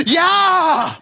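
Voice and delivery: sudden high yelled cry of pain; surgery patient screaming as the cut begins; retold scream. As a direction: yelp it out like the scalpel just hit. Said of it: "and I scream".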